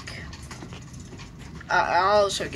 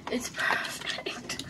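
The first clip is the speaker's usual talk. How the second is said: whisper; 7 dB quieter, slower than usual. Real, not acted. Whispering to himself in game.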